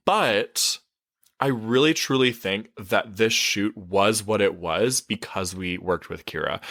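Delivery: ordinary talk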